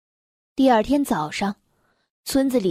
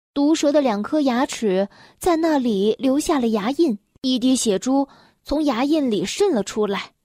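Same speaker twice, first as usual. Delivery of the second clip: ordinary delivery, nothing marked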